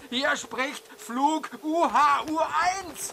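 high, panicked radio mayday; panicked pilot radio call. Acted.

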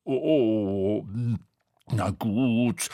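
reluctant, moaning surrender; whining. A narrator's reading. Tete agrees to be the ladder, whining. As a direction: give in with a drawn-out groan. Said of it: "jammerte Tete".

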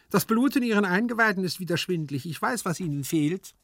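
high, quick servant voice; matter-of-fact servant figure. A narrator's reading. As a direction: diagnose the master briskly, high-pitched. Hyazinth voiced in the story. (hohe, flinke Dienerstimme)

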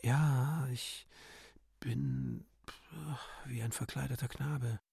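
soft, hushed character reply; quiet. Acted. Fredegonde answers Bébuquin in a hushed voice.

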